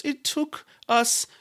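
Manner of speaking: high, deliberate mock Icelandic voice; slow-starting, lilting Icelandic imitation; Icelandic guide's accent. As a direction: draw out the answer like an Icelandic guide. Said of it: "He goes".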